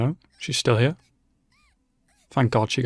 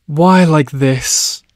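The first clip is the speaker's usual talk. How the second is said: very slow, louder, despairing; anguished plea; 4 semitones higher, 6 dB louder, much slower than usual. Real, not acted. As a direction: drag it out in disbelief. Anguish at a death scene.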